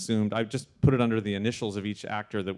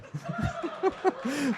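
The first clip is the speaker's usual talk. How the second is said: a sudden high burst of laughter; laughing at the teasing. Real, not acted. Teasing a colleague about the Baby Yoda voice, high laugh.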